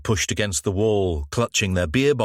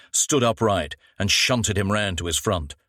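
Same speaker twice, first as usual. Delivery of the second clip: ordinary delivery, nothing marked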